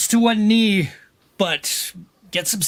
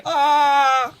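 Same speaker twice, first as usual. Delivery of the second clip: high, loud strained "ah"; struggling cry; 8 semitones higher, 3 dB louder than usual. Acted. Game master acts out a stabbed guard struggling, pained cry. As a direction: cry out as you struggle against the blade. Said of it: "He starts struggling".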